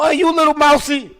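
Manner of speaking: high-pitched older woman impression; hammed-up older lady voice; imitated older women. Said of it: "when them older women see me, they go".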